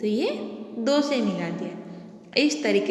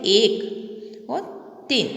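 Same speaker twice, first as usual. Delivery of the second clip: एक drawn out very slowly and clearly; correct careful reading; much slower than usual. Shown as a demonstration. Teacher models the right word एक slowly for children. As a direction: stretch एक out slowly so children copy it. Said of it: "क्या बोलते हैं".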